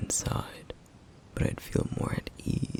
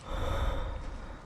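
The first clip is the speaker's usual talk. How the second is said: sigh. Acted. Weary pause in the hideout.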